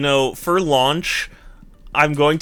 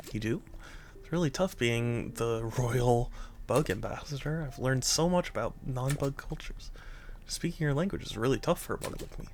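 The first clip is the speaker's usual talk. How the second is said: soft dialogue reading; 15 dB quieter than usual. Acted. Reading game dialogue aloud.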